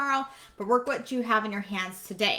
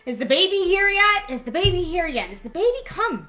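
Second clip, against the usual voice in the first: higher, eager questions piling up; eager waiting relatives; 7 semitones higher, faster than usual. Retold or imitated. Relatives in the hall ask about the baby, eager and higher. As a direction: play the relatives pestering for news. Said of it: "they're eagerly waiting".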